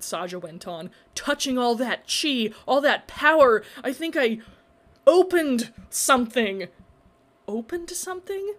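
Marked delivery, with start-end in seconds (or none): 1.2-6.7 awed intensity. A narrator's reading. Mystic revelation. 7.5-8.5 high, uneasy echo; fearful question. A narrator's reading. Dread rising.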